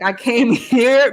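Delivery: mock-solemn retelling, slow and emphatic; so serious. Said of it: "I'm so serious".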